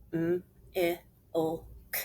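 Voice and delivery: "M-I-L-K" as letter sounds, not letter names; four separate sounds with gaps; sounded out. Shown as a demonstration. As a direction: sound out milk one phoneme at a time. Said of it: "Let's finger spell it".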